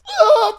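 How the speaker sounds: high, loud yell as he pushes; loud retold battle yell. Retelling the club fight to the hosts, a loud high yell. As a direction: let out a sudden, high war cry. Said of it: "I was like".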